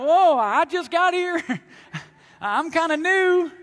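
lightly voiced startled new guy, with a laugh; a hint of a flustered new convert; surprised newcomer. New believer answering Jesus.

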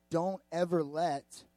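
ordinary delivery

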